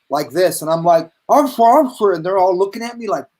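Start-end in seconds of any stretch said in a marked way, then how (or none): 1.3-2.1 louder, higher mock-alarmed appeal; mock panic. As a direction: imitate yourself pleading with an armed officer. Retold panic as gun is drawn.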